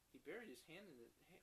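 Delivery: ordinary delivery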